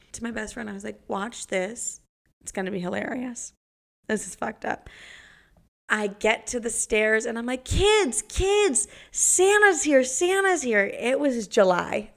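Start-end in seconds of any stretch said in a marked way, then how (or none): 0.1-4.9 much softer, conspiratorial hush; hushed aside. Retold or imitated. Telling best friend the prank plan, soft hushed voice. 7.6-10.8 very high, loud excited calling. Retold or imitated. Babysitter calling kids that Santa came, very high excited call.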